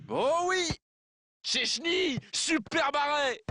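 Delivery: high excited save call; excited sports commentary. Acted. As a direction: shout the save with joy.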